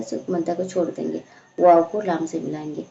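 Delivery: normal talking voice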